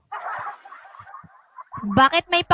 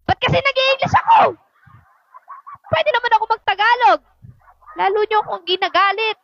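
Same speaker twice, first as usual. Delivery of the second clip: raised voice; 6 semitones higher than usual. Real, not acted. Vlogger scolds herself for speaking English, raised voice. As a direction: raise your voice in mock frustration. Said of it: "paglaki ng mata sa pagtaas ng boses".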